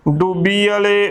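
ਡੂਬੀ ਵਾਲੇ with the first vowel cut to short u; mispronunciation demo. Shown as a demonstration. Teacher showing how congregations misread it, short-vowel ਡੂਬੀ ਵਾਲੇ. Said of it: "ਔਂਕੜ ਦੇ ਨਾਲ ਉਚਾਰਨ".

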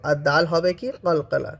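normal talking voice

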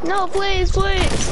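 high pleading please; anguished denial. Real, not acted. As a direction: beg desperately. Begging again.

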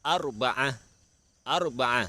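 อัรบะอะ high, syllable by syllable; model pronunciation. Shown as a demonstration. Teacher shows children Arabic four, อัรบะอะ pronounced high and clearly. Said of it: "ออกเสียงว่า".

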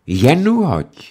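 loud, slow challenge; defiant dare. Acted. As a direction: dare him to strike. Defying the raised hand.

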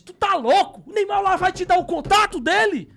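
high, loud, scornful disbelief; incredulous wife. Retold or imitated. Wife scoffing that Neymar would share his number, loud and high. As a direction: shriek it like a wife who can't believe him.

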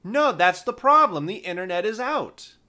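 a lightly voiced caller sure she's right; insistent caller.

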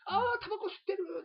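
put-on high child's voice crying out; pointing and tattling like a kid; kid catching him. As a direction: call it out like a kid who caught an adult smoking. Kid spots him smoking.

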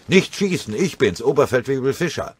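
hoch und laut gerufen; schreiend. Acted. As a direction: ruf verzweifelt aus dem Gebüsch. (laut und deutlich höher rufend)

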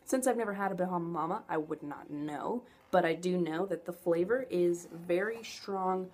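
ordinary delivery

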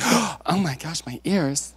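slow, clutching-pearls shock over the cussing; hammed-up "my ears" horror; mock scandalized prude. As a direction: play a church lady offended by swearing. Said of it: "I'm not gonna be like".

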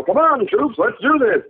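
rally the troops like a pompous leader; pompous speech-giver. Retold or imitated. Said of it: "becoming a big pompous speech giving nerd".

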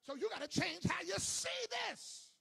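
loud hammering sermon delivery; preaching at full force. Acted. Preacher to congregation, full-force shouted preaching.

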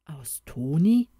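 slow, stretched-out echo of the name; puzzled uncle drawing it out slowly; drawn out. Acted. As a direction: drag the words out in disbelief. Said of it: "wiederholte der Onkel gedehnt".

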